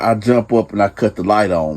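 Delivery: usual speaking voice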